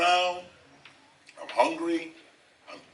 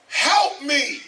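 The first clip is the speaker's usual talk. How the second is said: pleading; 9 semitones higher, 4 dB louder than usual. Retold or imitated. Retold desperate plea to something.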